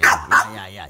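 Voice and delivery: sharp scold. Real, not acted. Scolding the panda.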